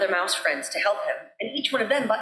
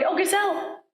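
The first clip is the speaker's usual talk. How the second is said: high pleading call; crying out; 9 semitones higher than usual. A narrator's reading. Mouse calls out to the gazelle, pleading. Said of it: "he cried".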